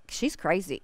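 driver's muttered verdict. Retold or imitated. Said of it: "and he's like".

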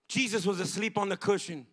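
ordinary talk

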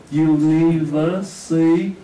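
University very slow with a rising end; slow model word. Shown as a demonstration. Teacher modelling University slowly for the students. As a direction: stretch University out for the class. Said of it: "Đọc với tôi được".

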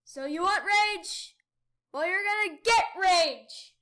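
loud, slow playful fury; hammed-up gamer rage; mock rage. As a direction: ham up the rage for the fans. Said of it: "it's funny when I rage".

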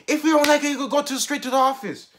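imitated angry teacher. Teacher threatening to send a student to the office, mock scolding.

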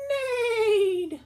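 high, loud whinnying neigh; whinny it like a rearing horse. Acted. Reading to kids, her best horse neigh. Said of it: "That's my best horse sound for today".

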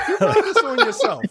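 high, laughing reproach; a laughing told-you-so; laughing scold. Real, not acted.

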